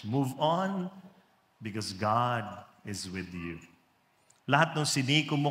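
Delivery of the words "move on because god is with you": low, soft and slow; sermon title. Acted. Preacher announcing the sermon message to the church. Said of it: "I would like to preach on this message".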